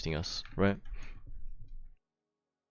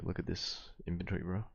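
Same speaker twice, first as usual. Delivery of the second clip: normal talking voice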